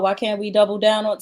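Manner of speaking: ordinary talk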